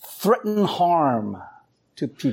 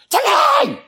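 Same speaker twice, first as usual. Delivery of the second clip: high shrill yell of a name; husband losing patience calling his wife upstairs, shouting; exasperated shout; 5 dB louder than usual. Retold or imitated. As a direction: bellow it after calling twice. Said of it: "after a couple of Chuckies, it becomes".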